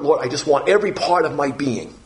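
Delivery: ordinary talk, nothing marked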